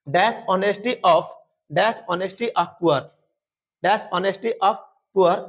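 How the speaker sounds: very slow, word by word; dictation pace. Shown as a demonstration. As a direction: dictate it slowly, phrase by phrase. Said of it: "teacher dictating sentence".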